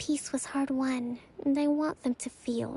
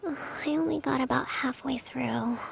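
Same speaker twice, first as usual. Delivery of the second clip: ordinary delivery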